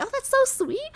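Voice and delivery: ordinary delivery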